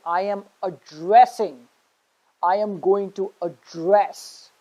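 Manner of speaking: slow, with "addressing" and "address" stressed on "dress"; stress on second syllable. Shown as a demonstration. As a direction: say "address" slowly as a verb, adDRESS. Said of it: "stress on the second syllable".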